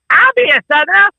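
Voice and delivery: over-the-top Southern holler; Southern accent. Acted. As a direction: belt it out in an eager Southern accent. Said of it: "I talk with a Southern accent".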